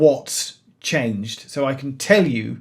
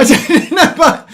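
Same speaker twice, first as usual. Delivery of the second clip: laughing; 12 semitones higher, 9 dB louder than usual. Real, not acted. Laughing while flustered.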